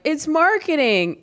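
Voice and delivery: high, slow nervous excuse; scared excuse. Acted. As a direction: meekly justify to mom. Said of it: "I look up frightened".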